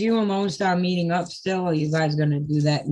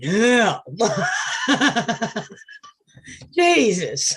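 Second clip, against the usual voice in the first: laughing; 4 semitones higher, much slower than usual. Real, not acted. Laughing after yelling at friend.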